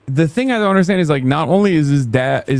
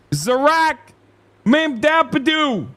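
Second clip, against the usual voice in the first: high, slow mocking drawl on the name; mock name mangling; 11 semitones higher, slower than usual. Mangling the mayor's name again.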